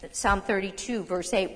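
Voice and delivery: ordinary delivery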